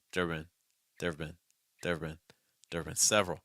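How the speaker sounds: "there have been" quick and low, have just a v; weak contraction. Shown as a demonstration. Teacher drills students, "there have been" lightly contracted. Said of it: "we're gonna get weaker and faster".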